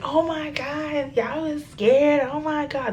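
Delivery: high, drawn-out mocking imitation; mock cracking up. Friend teasing the scared girls afterwards, mock cracking up. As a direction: mimic her gleeful teasing, slow and high. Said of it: "to be cracking up, like, laughing".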